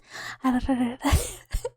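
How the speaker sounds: mock rolled R ending in a laugh; imitated R trill. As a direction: imitate people effortlessly trilling an R. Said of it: "people can just do it".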